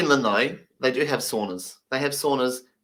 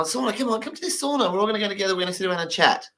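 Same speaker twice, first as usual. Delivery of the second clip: higher, eager coaxing; inviting deaf friends; 6 semitones higher, 3 dB quieter, faster than usual. Retold or imitated. Deaf friends invite him to the sauna, eager high coaxing. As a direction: lure the new guy in, pitched up and keen. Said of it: "They go".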